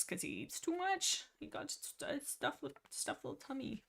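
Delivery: high, soft cooing; playful baby talk. Pretend. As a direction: coo over a poor little creature. Cooing over the overfed cat.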